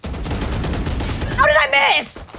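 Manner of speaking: high, drawn-out wail; exasperated cry. Real, not acted. Player who just missed a shot, wailing in disbelief.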